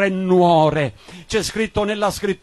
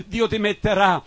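ordinary delivery

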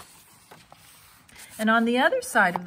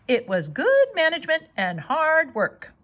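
proud goose; 7 semitones higher, 4 dB louder than usual. A narrator's reading.

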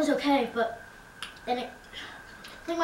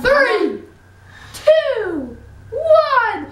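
loud countdown; 7 semitones higher, 8 dB louder, much slower than usual. Real, not acted. Countdown before eating sour candy.

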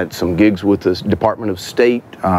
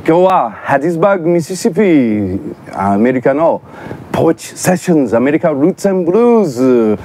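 high-pitched, fast Japanese show intro; lively radio-style greeting; show announcer; 6 semitones higher, much faster than usual. Acted. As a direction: announce the show brightly to listeners, pitched up and quick.

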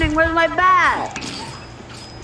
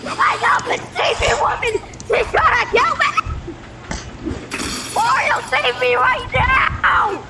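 panicked shouting; 6 semitones higher than usual. Real, not acted. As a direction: yell for rescue while being chased. Being chased in game, shouting.

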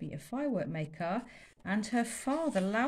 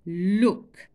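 loud sharp demand; angry command; 10 dB louder than usual. Acted. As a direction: make him look. Angry master pointing.

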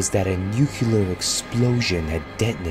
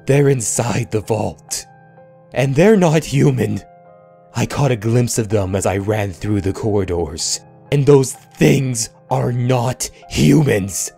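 panicked; 4 semitones higher, 6 dB louder than usual. A narrator's reading. Narrator panics as creatures enter.

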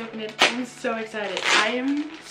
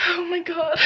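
laughing through tears; 6 semitones higher than usual. Real, not acted. Vlogger overwhelmed by a shared post, laughing tearfully. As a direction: giggly sob of joy. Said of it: "Why am I crying".

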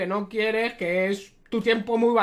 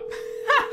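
airy laugh. Real, not acted. Laughs as caller hangs up.

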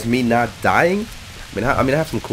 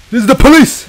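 high, full-force shout; mock police shout; 13 dB louder than usual. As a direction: holler it like a police raid. Calls out as police.

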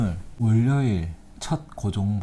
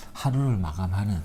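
usual speaking voice

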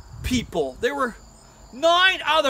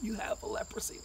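high, very soft near-whisper; stage-whispered dread; hushed diagnosis; 11 semitones higher, 12 dB quieter than usual. Acted. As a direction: break terrible news in a hush. Priest whispers the dreaded news.

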